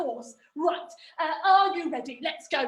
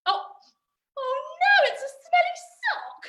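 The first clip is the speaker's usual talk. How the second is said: high-pitched comic wail; mock dismay; 11 semitones higher than usual. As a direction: lament a failed trick, hammed-up. Trick fails, mock dismay.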